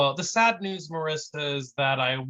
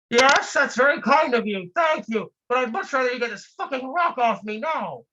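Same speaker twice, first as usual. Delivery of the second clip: high, loud mock thanks, then a sharp demand; sarcastic trapped man; 9 semitones higher, 3 dB louder than usual. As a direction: fake politeness, then blow up.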